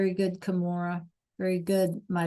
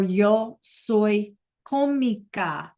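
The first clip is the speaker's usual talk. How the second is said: slow model sentence; much slower than usual. Shown as a demonstration. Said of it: "teacher models Spanish slowly".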